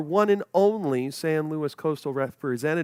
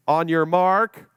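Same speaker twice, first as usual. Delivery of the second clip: race starter call; 6 semitones higher, 5 dB louder, slower than usual. Acted.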